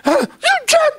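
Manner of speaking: very high-pitched mock scream; mock shriek in bursts; retold scream. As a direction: imitate a woman shrieking an accusation. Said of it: "dia sempat jerit".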